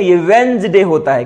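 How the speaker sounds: वेंसडे said as two syllables, the d silent; correct Wednesday. Shown as a demonstration. Teacher showing the class how वेंसडे is really said. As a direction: stress वेंसडे clearly, no "nes" in the middle. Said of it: "ये वेडनेसडे होता ही नहीं है".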